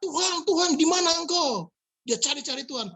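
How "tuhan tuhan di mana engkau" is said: crying out. Retold or imitated. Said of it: "Dan dia teriak-teriak".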